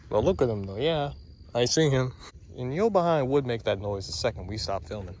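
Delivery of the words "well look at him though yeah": normal talking voice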